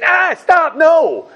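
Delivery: loud high-pitched panicked cry; mock scream. Light stopping a hand about to switch it off, hammed-up scream. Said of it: "the light is gonna scream at you".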